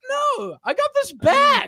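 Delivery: very high, slow, laughing imitation of a proud friend; proud Dave impression. Imitating Dave proudly showing off his bag, high and laughing. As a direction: squeak it out like a kid showing off a toy. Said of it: "He's like".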